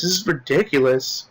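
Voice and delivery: ordinary talk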